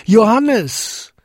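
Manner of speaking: high, loud call into the distance; a father's far-reaching call; calling as loud as he can. Acted. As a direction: shout the name down to him. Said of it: "so laut er konnte".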